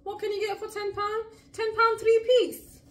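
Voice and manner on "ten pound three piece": loud, high and slow, savouring the price; excited bargain. Real, not acted. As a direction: announce the bargain with glee.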